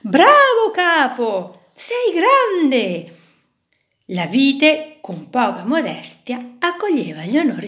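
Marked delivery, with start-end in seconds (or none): 0.0-3.2 high, loud, slow cheer. Acted. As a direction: hail the hero boss with delight. Fish cheering their new boss.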